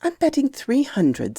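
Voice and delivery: put-on understated seriousness in a boy's voice; grave boy. A narrator's reading. Boy tells uncle his bet, gravely. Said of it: "said the boy gravely".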